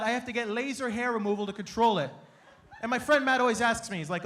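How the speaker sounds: normal talking voice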